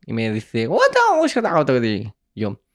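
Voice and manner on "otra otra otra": high-pitched foreign babble, mimicking the mom on the phone; imitated Korean mother. Narrator mimics the Korean mother on the phone, high and foreign-sounding. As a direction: squeak out the words like a confused older lady.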